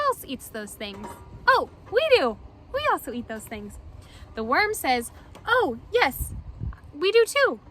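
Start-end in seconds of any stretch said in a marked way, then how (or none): 1.5-2.4 excited. Real, not acted. Excited answer to children. 5.5-7.6 eager worm voice. Acted.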